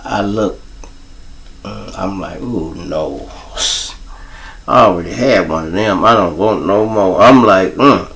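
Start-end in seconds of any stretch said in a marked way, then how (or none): 2.4-3.3 low, soft "no" to himself; hushed refusal. Retold or imitated. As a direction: drop low and quiet, recoiling. His reaction on seeing the lookalike.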